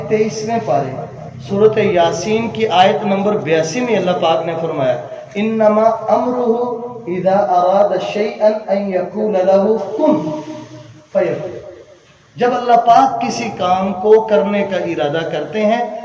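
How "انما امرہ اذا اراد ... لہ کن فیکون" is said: slow, loud, raised recitation settling at the end; Quran recitation. Acted. Preacher citing Surah Yasin to listeners, slow forceful recitation. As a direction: recite slowly and project it, landing the last word.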